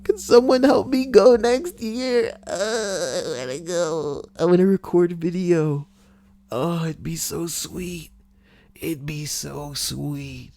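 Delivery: very high mock whine trailing off; whiny begging. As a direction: plead like a needy kid. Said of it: "begging to go to Comic-Con".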